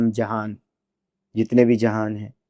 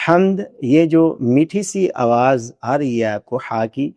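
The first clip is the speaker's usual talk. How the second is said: normal talking voice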